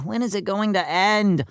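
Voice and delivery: ordinary delivery, nothing marked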